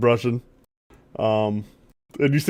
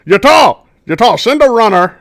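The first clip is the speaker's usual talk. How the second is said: raised voice, summoning the quartermaster; calling out; 7 semitones higher, 6 dB louder than usual. Acted. Captain shouting for his quartermaster, loud call. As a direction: call him over loudly across the deck.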